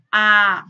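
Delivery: A said wide open and loud; open vowel A. Shown as a demonstration. Teacher demonstrating an open A to class. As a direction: open the mouth on A for students. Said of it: "Por exemplo, o A vai ficar mais abertinho".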